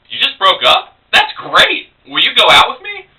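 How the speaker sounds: high, loud, delighted outburst; tactless excitement. Acted. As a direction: blurt it out far too eagerly. Tactless eager reply to breakup.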